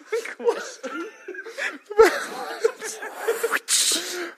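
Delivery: long high villain laugh; evil cackle. Acted. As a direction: cackle in triumph.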